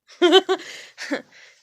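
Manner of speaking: real laughter bursting out; loud high laugh. Real, not acted. Laughing at the memory.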